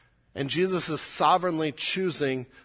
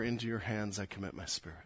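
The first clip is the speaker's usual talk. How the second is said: soft prayer; 4 semitones lower, 9 dB quieter, faster than usual. Shown as a demonstration. Congregation's own prayer of trust.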